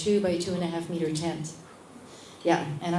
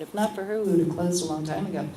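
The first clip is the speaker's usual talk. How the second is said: ordinary delivery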